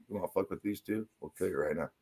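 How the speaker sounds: low, hushed retold menace; quiet mock threat. Acting out the threat at the bowling alley, quietly menacing. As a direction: drop very soft for the threat.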